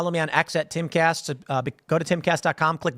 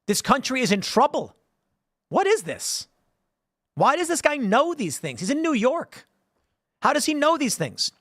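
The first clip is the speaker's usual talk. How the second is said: outraged FBI agent; 9 semitones higher than usual. Retold or imitated. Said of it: "be like".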